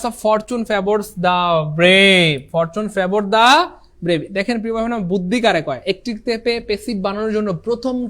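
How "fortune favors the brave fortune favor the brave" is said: a teacher slowly dictating the exam sentence; slow dictation. Shown as a demonstration. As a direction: read the English sentence out very slowly. Teacher reads English sentence.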